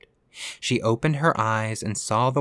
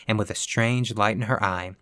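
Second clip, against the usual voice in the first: usual speaking voice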